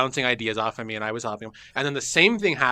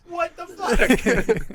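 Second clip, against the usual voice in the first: much higher, very slow drawn-out; incredulous disbelief; much slower than usual. Retold or imitated. Host learning the other did it, stretched incredulous cry. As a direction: stretch it in mock horror. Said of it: "I go".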